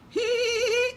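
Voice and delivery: very high mock neigh; whinny like a horse. Horse whinnying at the mouse, very high. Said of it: "sambil meringkih".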